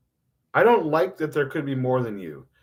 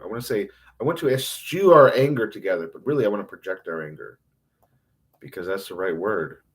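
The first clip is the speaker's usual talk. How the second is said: ordinary talk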